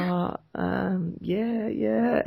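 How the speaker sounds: high, soft hesitant impression of agreeing; unsure playing along. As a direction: imitate someone pretending to remember. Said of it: "And he was like".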